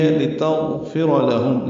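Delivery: لتغفر لہم very slowly, with a quick لام; drawn-out لتغفر لہم; tajweed demonstration. Shown as a demonstration. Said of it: "لام کو جلدی پڑھنا ہے،". (لتغفر لہم آہستہ، لام جلدی)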